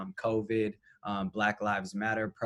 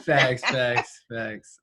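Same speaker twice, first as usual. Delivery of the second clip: laughing; 6 dB louder, much slower than usual. Real, not acted. Laughing agreement.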